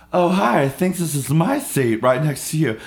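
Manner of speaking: high, loud chirpy greeting; bright, intrusive hello from the seatmate. Retold or imitated. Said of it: "right as the door closes".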